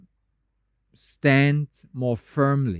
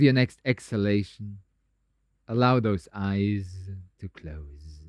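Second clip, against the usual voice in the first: soft, lowered meditation voice with long pauses; guided meditation; 5 semitones lower, 5 dB quieter than usual. Acted. Teacher settling meditators into a stable pose, calm guided meditation. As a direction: ease them down gently, softer and lower, leaving space.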